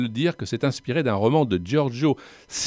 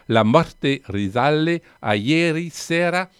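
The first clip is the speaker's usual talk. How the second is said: "La morte risale a ieri sera" said slowly in careful Italian; a French speaker daring the Italian title, slowly; slow, deliberate attempt at Italian vowels and rolled r; Italian pronunciation; 4 semitones higher, 3 dB louder, slower than usual. Shown as a demonstration. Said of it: "Allez, je me lance quand même".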